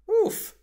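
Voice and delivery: high loud yelp of impact; a sudden hurt grunt; pained oof. A narrator's reading. Narrator bumps while swaggering.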